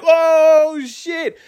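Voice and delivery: high squeaky gasp of shock; shocked reaction. Retold or imitated. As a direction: blurt it out in disbelief. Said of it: "I'm like".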